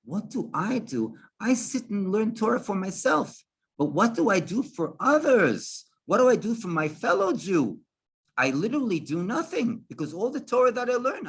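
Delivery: a lightning-bolt insight, voice rising; sudden realization. Retold or imitated. Sudden realization, talking to himself.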